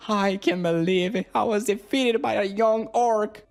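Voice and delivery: high-pitched, hammed-up voice of a beaten ant queen; mock disbelief from a fallen monster; trembling defeated queen. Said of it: "Trembling on the ground".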